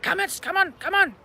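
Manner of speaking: high, loud, insistent "come on"; mock pushy yell. Mocking a pushy partner, loud high urging.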